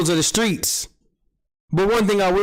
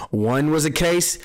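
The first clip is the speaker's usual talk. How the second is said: ordinary talk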